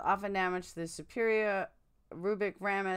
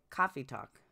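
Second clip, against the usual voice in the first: Boston accent; 5 semitones lower, 4 dB quieter than usual. Shown as a demonstration. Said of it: "Sounds like, like".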